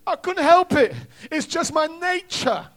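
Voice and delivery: loud, high acted wail; protesting excuse. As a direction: plead helplessness as the guilty scorpion. Scorpion excuses himself to frog.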